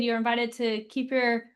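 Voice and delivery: ordinary talk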